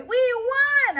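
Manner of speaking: very high, loud cheer; yelling. A narrator's reading. Girl celebrating the win, high loud cheer. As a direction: shout the win with joy. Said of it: "I yell".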